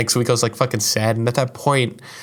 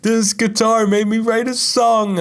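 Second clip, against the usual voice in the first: frustrated outburst; 11 semitones higher than usual. Retold or imitated. Wanting to smash his guitar, a high furious rant. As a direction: rage at the guitar, voice cracking up. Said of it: "I'm like".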